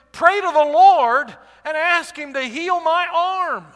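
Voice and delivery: loud, high, slow pleading; the king begging the prophet to heal him; 1 desperate plea. Acted. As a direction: beg for your arm back, loudly. King begs prophet for healing.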